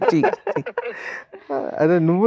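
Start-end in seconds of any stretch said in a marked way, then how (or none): 0.0-0.6 high squealing laugh into a protest; giggly chee chee through laughter. Real, not acted. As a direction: laugh helplessly and wave it off. Laughs at producer's comeback.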